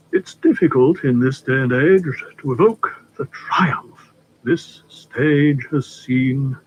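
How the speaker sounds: slowing dreamily to a fade; trailing into reverie. Acted. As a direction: drift off into a memory. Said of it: "His voice trailed away in a reverie".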